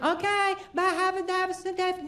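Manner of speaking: light high-pitched imitation of a wife; wife's reply. Acts out wife's cheerful reply.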